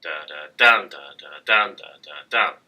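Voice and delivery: two light "da" then a strong "dum"; weak, weak, STRONG, over and over; anapest beat. Shown as a demonstration. Teacher chants the anapest rhythm for students. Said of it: "the iambic with just an extra little beat".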